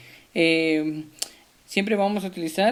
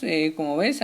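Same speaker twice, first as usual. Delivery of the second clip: ordinary talk, nothing marked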